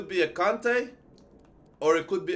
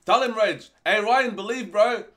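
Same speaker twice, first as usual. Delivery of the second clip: hyped up; 4 semitones higher, 6 dB louder than usual. Real, not acted. Hyping viewer's upcoming pack.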